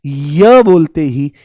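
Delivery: य drawn out slowly to reveal ई; slow demo of य. Shown as a demonstration. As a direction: stretch य so the ई shows. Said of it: "ध्यान से सुनने की कोशिश करो".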